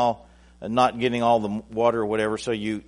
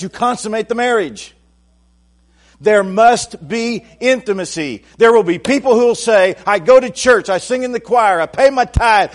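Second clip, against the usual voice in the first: high, loud preaching building to a roar; full-force preaching; 8 semitones higher, 7 dB louder than usual. Acted. Preacher thundering at the congregation, high and loud. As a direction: preach it out with rising fire.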